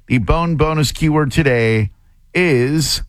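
upbeat contest promo read; radio announcer. Acted. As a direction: announce the contest prize with energy.

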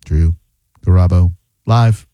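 "Drew... Garabo... live" in separate low chunks, long pauses between; low, halting "Drew", "Garabo", "live"; word-by-word delivery. Shown as a demonstration. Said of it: "I'm gonna say it another".

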